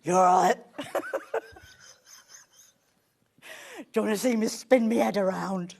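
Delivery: loud, gleeful unhinged toy voice; crazed panto creature with cackling laughs; manic creature voice. Acted. Voicing a creepy toy to the host, loud manic creature voice. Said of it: "It sounds like you've drunk a really big can of Coke".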